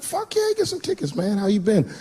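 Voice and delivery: high, eager yelp of delight; excited. Retold or imitated. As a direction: jump at it, all pumped up. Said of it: "I was like".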